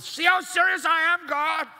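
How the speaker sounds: high, loud and frantic, pleading to God; frenzied worshipper. Acted. Frenzied prophet of Baal crying to God, high and loud. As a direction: shriek it like a man cutting himself in a frenzy. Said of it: "they cut themselves with".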